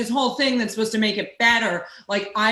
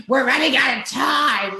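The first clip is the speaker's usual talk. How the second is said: raised pitch, a bit louder; lightly mocked nagging dick; 5 semitones higher, 3 dB louder than usual. Host mocking herself for rushing her friend, lightly acted nagging. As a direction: lightly play an impatient killjoy. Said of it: "I'm a dick who's like".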